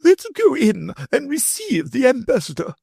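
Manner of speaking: the grand lady leading the way; lady's voice. A narrator's reading.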